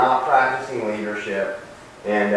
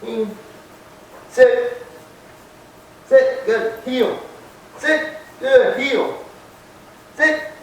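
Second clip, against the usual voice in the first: much higher, firm commands; dog commands; 10 semitones higher, much slower than usual. Real, not acted. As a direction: snap short high commands at the dog.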